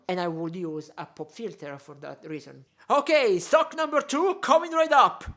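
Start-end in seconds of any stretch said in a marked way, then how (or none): 2.9-5.2 loud, high-pitched playful holler; mock announcer call. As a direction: call it out like an order coming up. Mock announcement of the next sock.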